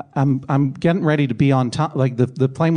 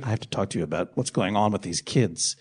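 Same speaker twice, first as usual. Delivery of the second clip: imitating the flight attendant; 7 semitones lower, 7 dB quieter than usual. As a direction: lower and softer, a hushed phone report. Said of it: "They go".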